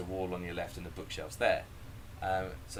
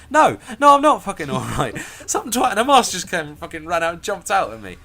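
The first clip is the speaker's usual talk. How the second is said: loud, high comic rant breaking into laughter; hammed-up outraged shouting; indignant outburst; 9 semitones higher, 13 dB louder than usual. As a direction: protest as if freshly scared out of your wits. Retells indignant answer to guide.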